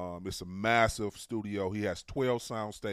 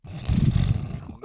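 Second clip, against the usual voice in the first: burst of laughter; 11 dB louder than usual. Real, not acted. Big laugh at joke.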